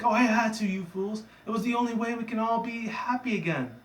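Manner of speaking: high, pleading-indignant character voice; acted villain self-defense. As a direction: justify it in a high cartoon voice. Exposed leader defending herself.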